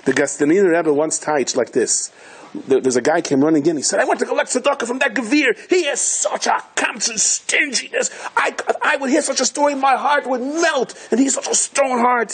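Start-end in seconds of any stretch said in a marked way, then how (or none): 4.0-12.3 higher, worked-up complaint; indignant petitioner. Retold or imitated. A man runs in to complain about the stingy rich man, mock indignant. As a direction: play the outraged collector.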